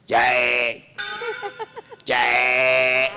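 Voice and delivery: low, rough vendor's cry of "jay"; deep hawker's call in the night; cracked tea-seller call. Retold or imitated. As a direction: bellow it like a hoarse chaiwala on the train. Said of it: "उनकी आवाज इतनी फटी".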